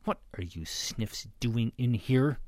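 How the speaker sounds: slow, tense, restrained question; gritted teeth. A narrator's reading. Ruby questions the intruding pigs, through gritted teeth. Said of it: "Ruby gritted her teeth".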